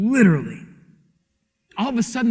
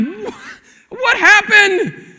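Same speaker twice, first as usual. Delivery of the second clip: high, slow, startled "what happened?"; a dad walking in on chaos, shocked; father bursting in to find his kids crying, alarmed; 11 semitones higher, 4 dB louder, much slower than usual. Retold or imitated. Panicked father finding crying kids.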